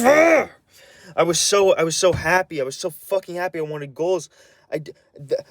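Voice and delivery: pouring out fury, straining; real anger breaking through. Real, not acted. Ranting to the camera about grandma, choked real anger. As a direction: voice cracking high and loud with rage. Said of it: "I'm so fucking angry".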